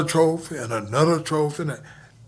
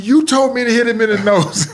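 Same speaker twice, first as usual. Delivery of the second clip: laughing; 7 semitones higher, 6 dB louder than usual. Retold or imitated. Retelling innocent reply, laughing.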